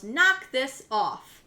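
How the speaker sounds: loud, very slow mock yell; retold yell. Mother yelling at her son to stop, slow loud mock shout. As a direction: bark it out slowly like a retold order. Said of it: "Ruby kicks him and yells".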